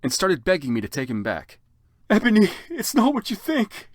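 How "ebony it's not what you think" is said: very high, louder whiny plea; sad scream. Acted. Draco begging Ebony back, high whiny plea. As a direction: wail it like a desperate boyfriend. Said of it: "Draco screamed sadly".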